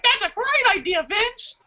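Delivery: high fawning yes-man squeak; sycophant voice. Retold or imitated. Yes-men flattering Vince, high mocking squeak. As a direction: suck up to the boss in a high voice. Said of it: "everyone else is like".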